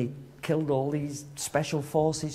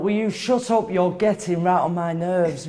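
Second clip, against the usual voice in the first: higher, louder exasperated snap; retold irritated telling-off; 4 semitones higher, 5 dB louder than usual. Boxer to the boastful woman in the queue, irritated telling-off. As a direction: tell her off, raised voice, dragging the complaint. Retelling her snapping at the woman.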